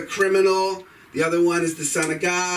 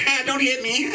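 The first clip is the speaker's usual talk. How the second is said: mock cowering plea; 7 semitones higher than usual.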